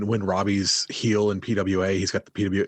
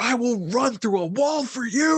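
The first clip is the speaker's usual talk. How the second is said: high, fired-up declaration; mock-pumped fan vow; hyped fan; 12 semitones higher than usual. Fan reacting to the match, high and hyped. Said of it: "I was like".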